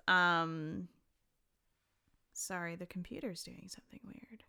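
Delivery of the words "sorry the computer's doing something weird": whispered, much softer apology; whispered aside. Real, not acted. Podcaster apologising mid-recording for the computer, whispered. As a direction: whisper it off-mic.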